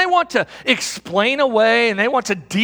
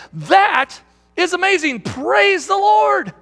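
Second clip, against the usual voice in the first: blurted-out praise; 8 semitones higher, slower than usual. Retold or imitated. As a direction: shout the praise out, stretching Lord. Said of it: "blurts out again".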